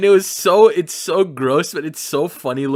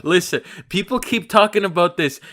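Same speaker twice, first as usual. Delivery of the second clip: usual speaking voice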